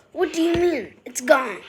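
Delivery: loud, high disbelief; alarmed. A narrator's reading. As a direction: demand it in shock. Harry alarmed.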